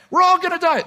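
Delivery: shrieked high and loud, over and over; screaming. Acted. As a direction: yell it in full panic. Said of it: "the man in front starts screaming".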